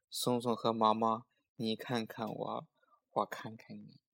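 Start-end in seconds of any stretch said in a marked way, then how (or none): none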